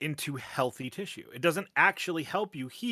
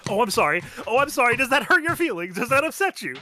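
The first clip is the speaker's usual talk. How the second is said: loud, high, taunting fake sympathy; mocking apology; 9 semitones higher, 8 dB louder than usual. Cohost taunting the upset host, loud mock apology. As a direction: pile on sarcastic concern at full voice.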